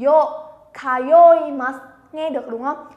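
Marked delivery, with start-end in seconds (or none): 0.1-1.8 "Yo" with a y glide, not Vietnamese dô; Japanese yo sound. Shown as a demonstration. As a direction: say "Yo" and "kayoimasu" slowly with a clear Japanese y. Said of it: "không đọc là dô hay là giờ của tiếng Việt". (đọc "Yo" chậm, rõ âm y tiếng Nhật)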